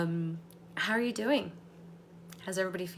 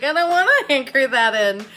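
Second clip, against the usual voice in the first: much louder, playful whiny voice; reluctant participant; 4 semitones higher, 15 dB louder than usual. Retold or imitated. Coach voicing a hesitant participant, whiny. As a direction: whine like a resistant student. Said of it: "someone was like".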